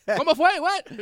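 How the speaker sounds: very high outraged bafflement; mock shocked listeners. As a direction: squeal like stunned Latin listeners. Imagines shocked Latin listeners.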